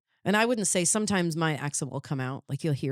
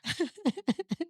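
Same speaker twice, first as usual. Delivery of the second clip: burst of laughter; 12 semitones higher than usual. Real, not acted. Laughs after accent demo.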